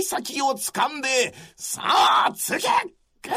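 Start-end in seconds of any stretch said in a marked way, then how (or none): 1.6-3.4 urgent shout. Acted. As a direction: urge him to strike, hard. Dying warrior urges the thrust.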